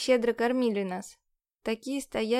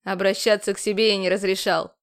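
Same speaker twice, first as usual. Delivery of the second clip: louder, curt and impatient; impatient snap; 3 semitones lower, 6 dB louder than usual. A narrator's reading. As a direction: cut her off impatiently. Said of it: "С нетерпением бросил тот".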